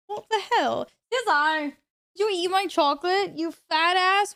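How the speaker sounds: shouting at the cat, high and loud; outraged yell at a thieving pet; yelling across the room. Real, not acted. Yelling at cat over chocolate.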